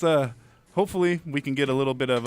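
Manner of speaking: usual speaking voice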